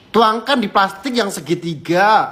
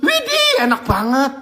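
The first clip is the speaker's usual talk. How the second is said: delighted squeal; 7 semitones higher than usual. Real, not acted. Tasting, delighted.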